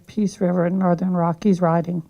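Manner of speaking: ordinary delivery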